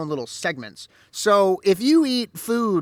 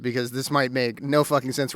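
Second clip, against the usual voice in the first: ordinary delivery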